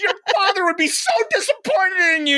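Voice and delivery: high-pitched outraged dressing-down; retold angry scolding. Parent scolding the girl at the bowling alley, outraged re-enactment. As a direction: re-enact losing it while shaming someone. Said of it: "lost my shit on these people".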